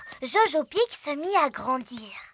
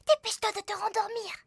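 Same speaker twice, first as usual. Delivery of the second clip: normal talking voice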